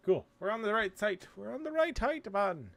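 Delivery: high, delighted cheer; excited. Real, not acted. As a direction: celebrate hitting the mark.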